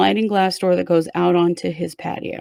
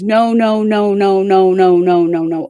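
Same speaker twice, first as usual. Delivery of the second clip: mild mock yell, louder rapid-fire repeats; retold yelling; 6 dB louder than usual. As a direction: imitate repeated yelling without really shouting. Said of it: "She yells".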